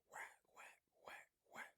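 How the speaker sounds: breathy whispered whack whack; hushed mock stabbing noises; whispered stab sounds. Acting out three men stabbing.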